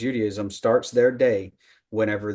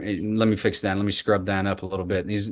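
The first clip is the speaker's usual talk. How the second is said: ordinary talk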